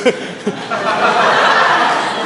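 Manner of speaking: a burst of real laughter at his own joke. Real, not acted. Pastor laughing at his own free booze joke to the church, real laughter.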